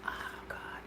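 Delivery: breathless whispered oh God; a moved, barely voiced sigh of words; emotional whisper. Real, not acted. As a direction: let it escape almost without voice. Overwhelmed, speaking about her kids.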